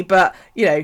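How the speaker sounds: ordinary talk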